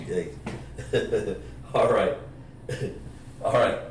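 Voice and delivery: normal talking voice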